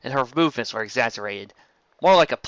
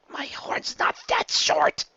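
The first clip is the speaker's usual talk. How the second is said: whispered objection; 4 semitones higher, much slower than usual. A narrator's reading.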